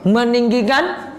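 ordinary talk

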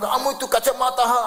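a stingy father yelling at his son; angry scolding. Pretend. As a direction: snap at the kid over broken glasses. Miser father scolding his child.

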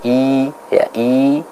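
i, soft and drawn, jaw dropped; full kasrah vowel. Shown as a demonstration. Teacher modelling the kasrah vowel, soft demonstration. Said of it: "menurunkan rahang bawah kita ke bawah".

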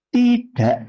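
"Tidak" ending in a glottal stop, tida', no released k; spoken pronunciation. Shown as a demonstration. Teacher repeating how it is spoken, spoken pronunciation. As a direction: swallow the final k of "Tidak". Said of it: "Tapi ngomongnya".

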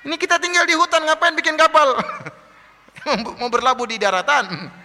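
very high jeer with a chuckle; mocking. Forest dwellers laugh at Noah building a ship, mocking. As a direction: ridicule him, pitched right up. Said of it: "Diejek Nabi Nuh".

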